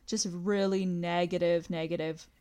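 usual speaking voice

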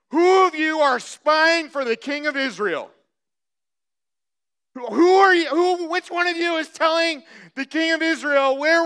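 loud, high-pitched royal outburst; agitated king. Acted. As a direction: demand answers from your servants, worked up. Voicing the enraged king of Syria.

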